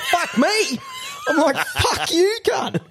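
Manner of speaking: very high shouted insults breaking into laughter; escalating shout. Retold or imitated. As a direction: scream the last insult then crack up. Quarrel peaks in shouting.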